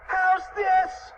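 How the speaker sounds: very high, frantic cartoon cry; mock scream. A narrator's reading. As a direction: squeal the question high. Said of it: "he screamed".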